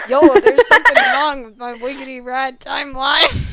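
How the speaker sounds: loud cool-guy voice breaking into laughs; laughing dude voice. Acted. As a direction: yell it like a goofy hip dude, laughing.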